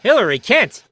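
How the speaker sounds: sharp rising shock at the name; can't believe what he's hearing; a loud, high, startled question; stunned disbelief. Acted. Inspector stunned by the reveal.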